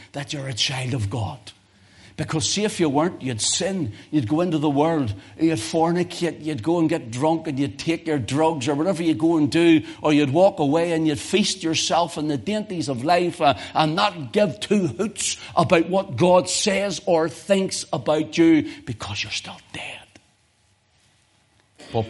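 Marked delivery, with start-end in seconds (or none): 2.2-18.7 louder and higher, building through the list; climbing sermon intensity; rising preaching fervor. Real, not acted. Preacher rising to full force. 18.9-20.0 whispered punchline; sudden whisper. Real, not acted. Drops after loud build.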